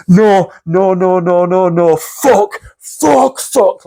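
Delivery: screaming no in terror, pitch climbing on the fucks; panicked no and fuck. Retold or imitated. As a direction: cry out in terror, reliving it. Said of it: "like this".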